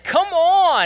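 high, loud prodding; urging holler. Retold or imitated. Stranger wants the fan's reply, loud urging. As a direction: call out high, coaxing the missing answer.

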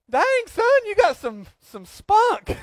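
very high, amazed exclamation; mock impressed. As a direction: marvel at his nerve. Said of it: "I'm like".

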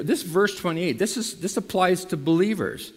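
normal talking voice

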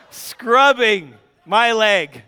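ordinary talk, nothing marked

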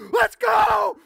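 loud, high battle shout; fierce shout. Retold or imitated. Pastor retells yelling at the goose, loud high battle cry. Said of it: "And I go".

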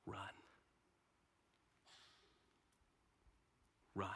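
barely audible, spaced-out villain whisper; hushed story-villain voice; Scar's hushed command. Acted. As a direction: whisper it as a sinister order. Acts Scar's menacing whisper.

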